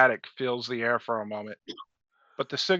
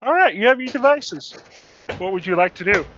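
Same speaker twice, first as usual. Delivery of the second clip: NPC voice; 5 semitones higher, 6 dB louder than usual. Acted. GM voicing an NPC.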